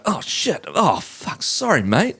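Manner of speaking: put-on "whoops, sorry" voice; mock apology. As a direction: act lightly flustered after a staged spill. Acting out a staged accidental spill.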